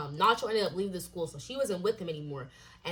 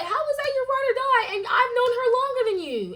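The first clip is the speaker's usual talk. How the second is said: much higher, loud incredulous challenge; shrill outrage; 7 dB louder than usual. Retold or imitated. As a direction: squeeze the words out high in disbelief. Angry retold argument, voice strained.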